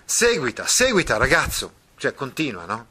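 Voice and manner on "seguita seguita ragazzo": high, insistent go on; Mastro Pietro urging on. Acted. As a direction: push the boy to continue. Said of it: "puppeteer urges the boy on".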